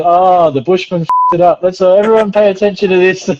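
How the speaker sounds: playful imitation of being singled out; imitating the teacher. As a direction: lightly put on a teacher calling everyone's attention. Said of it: "he's like".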